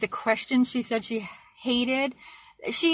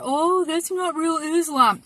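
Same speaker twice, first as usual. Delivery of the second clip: mocking quote; 6 semitones higher, slower than usual. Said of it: "You hear".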